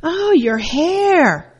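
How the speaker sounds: drawn-out high, slow mock dismay; mother's disapproving sigh. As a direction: imitate mom sighing over the curls. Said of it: "she goes".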